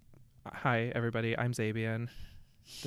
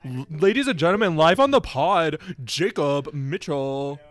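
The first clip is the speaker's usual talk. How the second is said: announcer; 2 semitones higher, 12 dB louder than usual. Pretend. Announcing a guest like a host.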